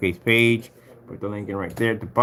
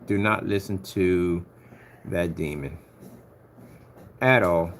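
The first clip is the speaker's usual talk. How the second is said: slow, emphatic warning; 3 semitones lower, slower than usual. Real, not acted. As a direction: DO NOT said slowly and deliberately. Repeats the warning more deliberately.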